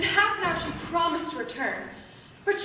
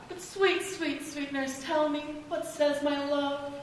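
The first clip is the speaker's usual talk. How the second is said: tender coaxing; 2 semitones lower, 7 dB quieter than usual. Acted. Juliet coaxes the nurse for news, soft pleading. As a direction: wheedle gently, softening to a hush.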